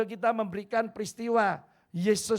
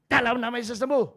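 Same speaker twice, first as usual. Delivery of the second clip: loud retold prayer; 3 semitones higher, 7 dB louder, faster than usual. Pastor prays again, louder.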